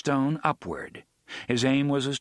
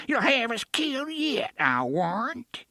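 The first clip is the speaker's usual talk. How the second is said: put-on cracked quavering old woman; 12 semitones higher than usual. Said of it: "in a cracked, quavering voice".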